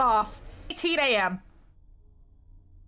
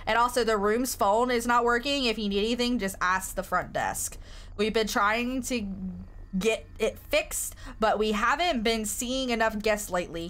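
usual speaking voice

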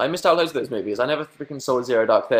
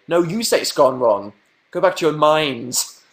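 mock indignant retort; 4 semitones higher, 5 dB louder, slower than usual.

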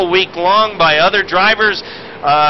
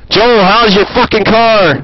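loud, slowed shout, higher up; radio shout; 5 semitones higher, 6 dB louder, slower than usual. Acted. Commentator yells at driver over radio, mock-loud shout. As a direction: bellow the question down the radio.

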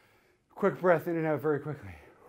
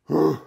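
loud relieved whew; relieved exhale; 3 semitones lower, 7 dB louder than usual. Real, not acted. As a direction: blow out hard with relief. Relief after set.

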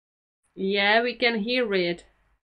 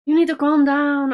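loud, higher insistent command; loud demand; 6 semitones higher, 10 dB louder than usual. Acted. Scolding the mother in the video, loud demand. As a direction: order her to calm down, loud.

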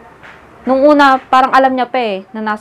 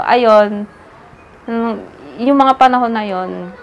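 ordinary talk, nothing marked

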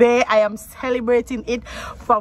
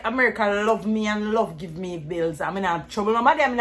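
ordinary talk, nothing marked

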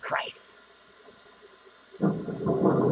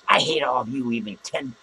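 ordinary delivery

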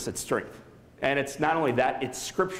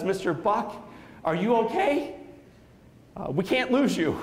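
higher-pitched nurse with rising questions; concerned nurse; 6 semitones higher than usual. Retold or imitated. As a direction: imitate a worried nurse, pitched up. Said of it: "One of the nurses walked over".

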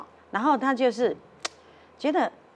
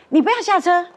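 sudden fierce shout, much higher and louder; 凶狠喝止; 8 semitones higher, 9 dB louder than usual. Retold or imitated. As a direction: snap at him to stay in the car. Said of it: "他就突然很凶说". (突然很凶地大声喝止)

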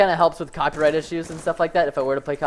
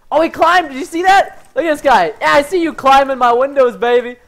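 loud, high-pitched excited spotting; excited callout; 7 semitones higher, 7 dB louder than usual. Real, not acted. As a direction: call it out loudly, worked up. Spots enemy climbing in game.

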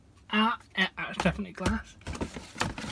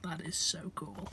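quiet awe; 11 dB quieter than usual. Real, not acted. Hushed delight at collectible.

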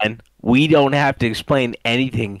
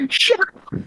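high shrill-pitched mock snap; husband mimicking his yelling wife; mock angry wife. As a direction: imitate the wife telling him off. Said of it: "She's like".

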